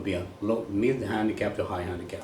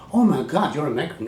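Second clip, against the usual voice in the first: mock amazement; 7 semitones higher, 6 dB louder than usual. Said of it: "they will go".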